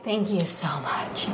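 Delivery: slow, low, soft thank-you; drawn-out, subdued mock gratitude; laboured thanks. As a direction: drag out the thanks quietly, as if it takes effort. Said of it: "I don't wanna be like".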